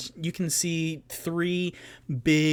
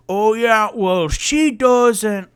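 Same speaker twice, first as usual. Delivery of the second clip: loud, higher, angry dwarf retort; 8 semitones higher, 9 dB louder, slower than usual. Acted. Angry dwarf retorting to Tutu, loud and high. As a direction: bellow back, dragging out the end. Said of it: "staring even angrier".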